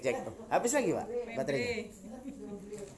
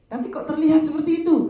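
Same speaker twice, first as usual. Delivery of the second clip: higher, louder amazement; amazed; 10 semitones higher, 10 dB louder than usual. Real, not acted. Preacher marvels to the congregation, high and loud.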